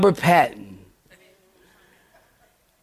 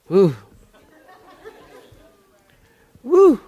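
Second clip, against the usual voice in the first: high mock woo; 6 semitones higher than usual. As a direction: yelp woo like catching a bad smell. People recoiling from smell.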